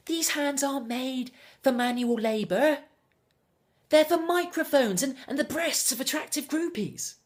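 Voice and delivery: high, indignant, grandly offended; affronted diva. Acted. Would-be star offered cleaning work, loftily indignant.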